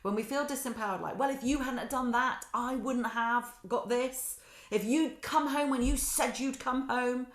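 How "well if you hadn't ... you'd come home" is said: accusing complaint. Retold or imitated. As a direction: blame him for coming home late. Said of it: "Those are the kind of conversations I used to have".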